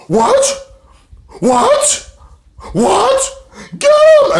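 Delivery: high, loud frantic screeching; mock frantic yelling. Laurel screeching at Kongo Kong to attack. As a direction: shriek it like a tipsy manager egging on.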